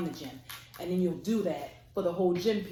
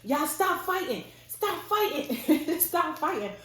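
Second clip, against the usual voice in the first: high mock yell breaking into laughter; laughing, drawn-out high holler; imitated yelling; 10 semitones higher, 4 dB louder, slower than usual. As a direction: imitate a teacher calling out, amused. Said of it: "yelling like".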